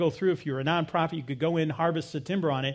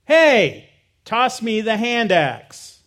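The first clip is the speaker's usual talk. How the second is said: loud, raised-pitch call across to someone; retold yell; 5 semitones higher, 8 dB louder, much slower than usual. Smarmy guy calls for the hand axe, retold yell. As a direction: call it out loudly, as if retelling a yell. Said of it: "yells out".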